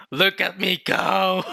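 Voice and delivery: high, slow crowing; gleeful boasting. Real, not acted. Fan showing off a correct guess, gleeful boast. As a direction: crow it high, stretching the words.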